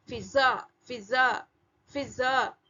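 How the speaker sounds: फिजा with a lip f and a buzzing z; teacher repeating फिजा; nukta f and z. Shown as a demonstration.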